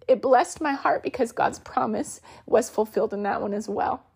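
normal talking voice

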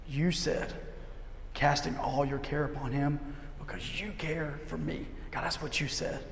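soft, hushed plea to God; sincere prayer. Shown as a demonstration. Preacher showing how he prays scripture to God, soft prayer. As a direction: pray it softly and earnestly.

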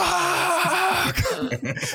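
high squealing laugh. Real, not acted. Laughing after the mock curse.

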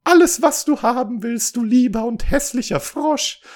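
very high, loud wailing princess; hammed-up sobbing. A narrator's reading. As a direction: plead desperately in mock sobs. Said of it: "und so schluchzte sie". (übertrieben schluchzend, sehr hoch)

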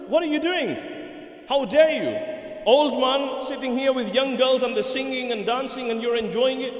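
high, scolding outburst of outrage; indignant rebuke. Retold or imitated. Caliph scolding an old man caught with singing girls, indignant. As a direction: confront him, pitched high and forceful.

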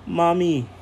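high, loud cry of relief; joyful child cry. Acted. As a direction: call out to her mother, overjoyed. Girls run to lost mother.

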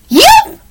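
sudden, very high yelp; dog yelp. A narrator's reading. Startled dog yelps in story.

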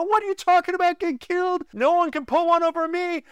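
hammed-up tough-guy retort; cocky husband. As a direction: brush her off like nothing can touch you. Retold quarrel, his cocky reply.

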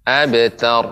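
abtar with a crisp b and t, heavy final ra; clear abtar. Shown as a demonstration.

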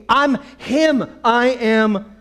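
loud, high, emphatic claim; exasperated insistence. Acted. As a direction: hammer it home loudly. Blind man insists again.

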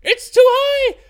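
high, loud exclamation of disbelief; exasperated, high-pitched yell; outraged shout. Real, not acted. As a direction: blurt it out at the top of your voice. Comic outrage at the number.

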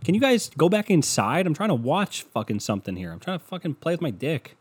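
fed-up man yelling at neighbors off his balcony; annoyed complaint. Retold or imitated. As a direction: whine at the neighbors to go inside. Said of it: "And I'd be like".

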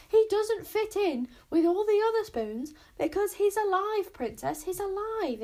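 loud, high-pitched exasperated outburst; interrupting. A narrator's reading. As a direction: cut in forcefully, drawing out the final "alive". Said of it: "interrupted Bodkin".

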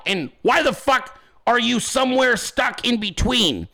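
ordinary talk, nothing marked

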